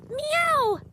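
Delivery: sweet mock kitty meow; cat meow. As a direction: mew like a cozy kitten. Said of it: "They say".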